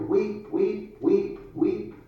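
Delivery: high, sing-song little sweep calling weep; child's street cry. Acted. Little sweep calling out his trade cry, high and sing-song. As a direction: cry it out like a small chimney sweep touting for work. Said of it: "while yet my tongue could scarcely cry".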